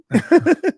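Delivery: a sudden high squealing laugh; gleeful laugh jumping up high; high gleeful cackle. Real, not acted. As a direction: cackle with glee at the news. Delighted at the fresh contract.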